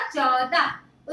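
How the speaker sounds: "चौदह" very slow and low, stretching the au; teacher's slow model of "चौदह"; sounded-out word. Shown as a demonstration. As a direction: draw out "चौदह" in two long syllables. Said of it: "च में औ की मात्रा द ह".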